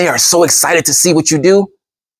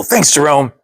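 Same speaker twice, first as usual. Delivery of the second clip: ordinary delivery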